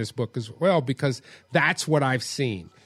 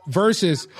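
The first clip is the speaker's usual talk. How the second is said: usual speaking voice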